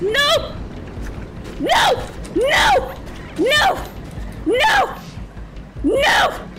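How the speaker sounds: high, punched cries in bursts; high-pitched, defiant panic; frantic refusal. Real, not acted. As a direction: yelp each word while fleeing. Frantic refusals while chased.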